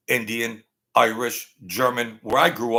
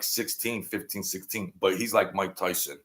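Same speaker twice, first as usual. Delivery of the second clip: ordinary delivery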